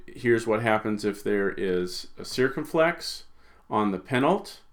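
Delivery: ordinary talk, nothing marked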